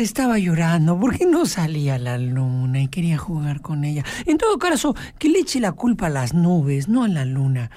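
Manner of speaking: usual speaking voice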